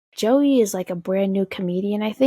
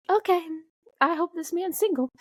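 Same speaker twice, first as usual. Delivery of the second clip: much higher, hopeful little voice; high-pitched aside; 10 semitones higher than usual. Retold or imitated. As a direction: say it way up high, like a private wish. Acting out her own hopeful reaction.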